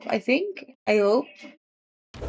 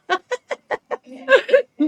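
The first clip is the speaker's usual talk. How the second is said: high burst of laughter; 4 dB louder than usual. Real, not acted. Giggles at silly name Muffin.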